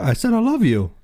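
high-pitched mock whine; mock pleading boyfriend. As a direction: squeak it out like a hurt lover. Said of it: "you're like".

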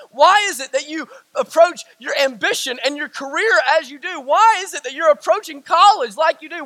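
ordinary delivery

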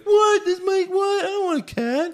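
very high-pitched imitation of a startled reply; mock high squeal. Retelling a character refusing a cat, mock high squeal.

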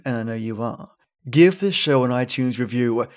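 ordinary talk, nothing marked

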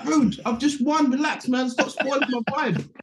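very high, whiny pushback; hammed-up annoyed young player; young player telling the manager to relax; mock protest. Young player pushing back.

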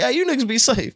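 high, cheerful send-off; friendly reply. Retold or imitated. Teller answers the robber.

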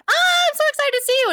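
very high excited squeal. Retold or imitated. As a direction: gush at them, way up high. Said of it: "they're like".

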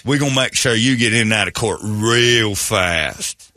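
country lawyer's hammed-up Southern accent; mock Southern accent. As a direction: reassure the client in a Southern drawl.

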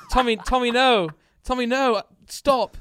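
high, loud, laughing protest; mock panicked plea. Ghostwriter pleading with gangster friend, mock panic, high and loud. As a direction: beg him to stop in hammed-up alarm. Acted plea during role-play story.

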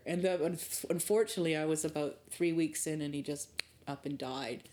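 ordinary talk

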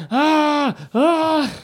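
high-pitched mock screams; imitated scream. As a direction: scream like the man in the video, twice. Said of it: "I liked how he was screaming".

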